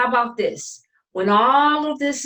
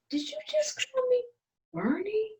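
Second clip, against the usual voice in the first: high, soft, disbelieving question; surprised; 13 semitones higher, 8 dB quieter than usual. Real, not acted. Sister's surprise at old nickname.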